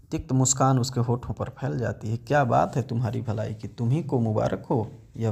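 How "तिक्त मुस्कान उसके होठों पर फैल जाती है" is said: ordinary delivery, nothing marked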